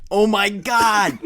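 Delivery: loud, slow, drawn-out gasp of disbelief; stunned. Real, not acted. Host hearing Musk challenged Putin, loud disbelief. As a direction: react like you can't believe it.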